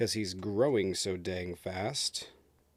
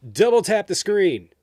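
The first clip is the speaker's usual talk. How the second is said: loud hype; 6 semitones higher, 7 dB louder than usual. Real, not acted. Hyping up viewers to engage.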